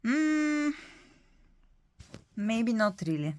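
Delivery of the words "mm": ordinary talk, nothing marked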